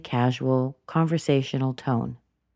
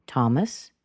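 THOMAS lifting at the end; casual rising intonation; 2 semitones lower than usual. Shown as a demonstration. Answering what's your name, casual upward intonation. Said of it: "It goes up".

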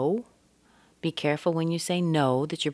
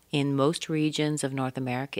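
ordinary talk, nothing marked

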